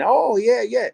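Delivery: high, sudden "oh yeah" of recognition; mock sudden delight. Staff suddenly warming up on hearing his accent, imitated high and eager. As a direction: snap into eager interest.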